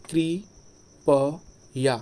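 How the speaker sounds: कृपया drawn out very slowly, कृ clear; slow correct form. Shown as a demonstration. Teacher slowly modelling the correct कृपया. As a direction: stretch कृपया syllable by syllable. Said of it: "कृपया, ये शुद्ध होता है".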